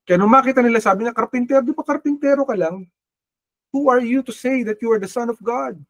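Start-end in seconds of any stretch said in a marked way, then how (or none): 1.2-5.8 higher, scornful taunt from the crowd; mocking crowd. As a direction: sneer like the doubting townsfolk. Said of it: "yun ang sabi nila".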